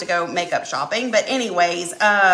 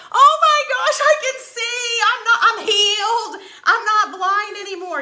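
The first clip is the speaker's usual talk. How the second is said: much higher, loud, laughing joy; overjoyed; 12 semitones higher, 3 dB louder than usual. Retold or imitated. Telling her husband she can see again, laughing joy. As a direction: squeal it like a miracle cure. Vision healed with readers.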